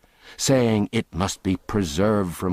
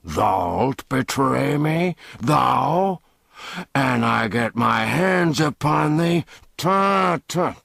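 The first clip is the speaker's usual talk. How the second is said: higher, louder, rising rage; furious threat; 7 semitones higher, 3 dB louder, slower than usual. Acted. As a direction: go for his throat. Furious man lunges at the boy.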